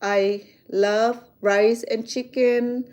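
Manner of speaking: slow, deliberate correct sentence; slow careful English. Shown as a demonstration. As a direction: spell it out slowly. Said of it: "If you try to speak English, you would say".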